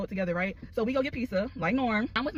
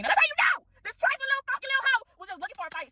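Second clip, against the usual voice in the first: outraged; 14 semitones higher, 3 dB louder than usual. Real, not acted. Outraged at girl who swung.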